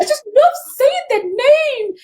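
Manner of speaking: put-on very high-pitched French-accented gushing; playful mock French accent, high; heavy French accent. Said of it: "such a heavy French accent".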